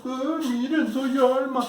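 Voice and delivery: high, loud goofy sleepwalker voice; mock her as a sleepwalker. Mimics Alma sleepwalking. (imitación burlona de sonámbula)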